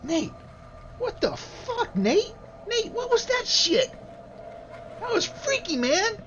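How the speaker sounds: put-on high-pitched little child's voice. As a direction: squeak it out like a spooked kid. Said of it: "you hear a, a little voice say".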